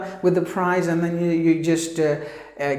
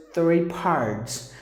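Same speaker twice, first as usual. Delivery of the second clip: ordinary talk, nothing marked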